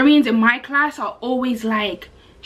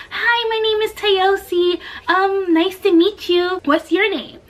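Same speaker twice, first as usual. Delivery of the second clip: higher, slower, sugary introduction; cute sweet voice; 6 semitones higher, slower than usual. Retold or imitated. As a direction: greet the shy neighbour sweetly and slowly. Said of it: "nice, cute, sweet voice".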